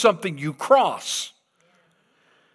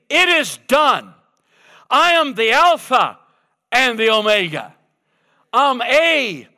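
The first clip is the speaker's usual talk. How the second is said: higher, louder triumphant proclamation; preaching God's words; 6 semitones higher, 4 dB louder than usual. Acted. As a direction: thunder it out as God. Voicing God, preaching full force.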